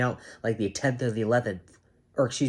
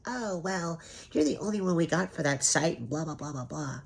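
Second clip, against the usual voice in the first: mocking scheduler; 6 semitones higher, 4 dB quieter than usual.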